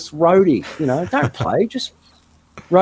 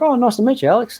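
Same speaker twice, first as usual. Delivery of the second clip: much higher, chirpy polite greeting; mock starstruck; 8 semitones higher than usual. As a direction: play it sweet and clueless. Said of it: "And I was like".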